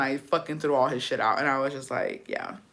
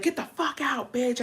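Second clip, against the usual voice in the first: high-pitched, held-back mock yell; mock furious eviction; 8 semitones higher, 6 dB quieter than usual. Girlfriend throwing boyfriend's clothes out, mock-angry.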